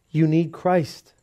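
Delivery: slow, earnest, every word weighed; a preacher slowly urging a man on the street to turn to Christ. Retold or imitated. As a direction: press it home slowly and sincerely.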